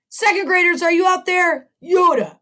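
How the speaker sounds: high, loud call to the room; teacher calling to second graders across the room. Real, not acted. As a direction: call out to the whole class. Calling out to the class.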